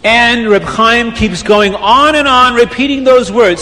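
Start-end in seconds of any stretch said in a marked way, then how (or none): none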